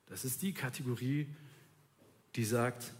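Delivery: ordinary talk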